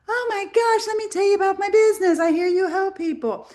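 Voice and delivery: high, fast, gushing chatter; gushing friend. Retold or imitated. [name] mimics the friend gushing about her business, high and fast. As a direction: play the eager friend who won't stop pitching. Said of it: "the friend's friend was like".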